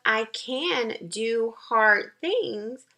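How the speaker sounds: very slow, every word weighed; slow affirmation. Shown as a demonstration. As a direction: spell out the affirmation slowly for the kids. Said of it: "So the affirmation of".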